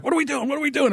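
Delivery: high, urgent mock panic; imitating a frantic Jerry. Host imitates a late-arriving guest, high and frantic. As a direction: play Jerry scrambling to get back in the fight. Jerry comes back, retold.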